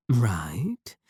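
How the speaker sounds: breathy unvoiced whisper; a creepy whispered aside to the last viewer. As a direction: lean in and breathe the word.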